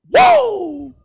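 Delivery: whoa shouted high and loud; hyping the crowd about the game; big whoa. Real, not acted. Hype exclamation on stream.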